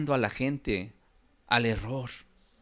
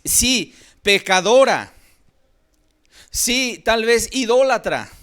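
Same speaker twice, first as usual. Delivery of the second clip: louder, higher impassioned sermon voice; fervent preaching; 8 semitones higher, 5 dB louder than usual. Acted. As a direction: preach it with rising fervour. Preacher builds the scene. (predicación fervorosa, más alta y fuerte)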